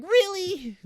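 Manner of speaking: very high, loud incredulous squeal; mock disbelief. Imitating Wiccan's reaction, loud high mock disbelief. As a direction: shriek it in hammed-up surprise.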